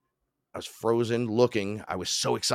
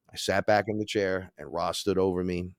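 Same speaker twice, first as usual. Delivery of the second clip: usual speaking voice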